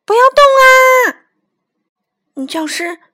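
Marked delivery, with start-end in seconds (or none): 0.0-1.3 high, very loud drawn-out command; teacher's full-force shout. A narrator's reading. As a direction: yell slowly for everyone to freeze. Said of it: "女教师叫道".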